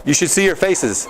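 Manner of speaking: ordinary talk, nothing marked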